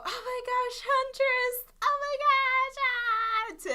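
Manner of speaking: high, excited fangirl squeal; Ruby. Retold or imitated. Host imitates young Ruby thrilled at being a Huntress, high squeal. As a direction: squeal it very high, stretching the last words. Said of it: "Ruby, we've seen her grow up".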